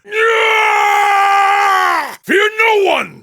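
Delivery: high, loud war shout; fierce rallying yell; battle cry. Acted. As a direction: roar it as you charge in.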